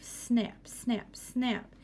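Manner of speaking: ordinary delivery, nothing marked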